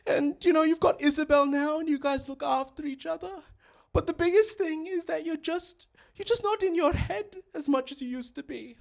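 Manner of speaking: high, tender mother voice; put-on high voice of an encouraging mum; proud mum. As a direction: reassure your child in mum's high-pitched voice. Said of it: "And she's like".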